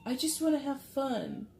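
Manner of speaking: high-pitched acted plea for fun; light, high mock whine; playful inner voice. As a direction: play the fun-loving side protesting. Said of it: "internal dialogue with yourself".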